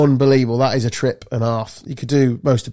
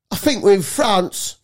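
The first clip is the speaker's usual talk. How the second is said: higher, drawn-out mock Cockney announcement; obnoxious Cockney; 6 semitones higher, slower than usual. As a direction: imitate an obnoxious Cockney, slow and raised in pitch. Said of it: "there's this cockney".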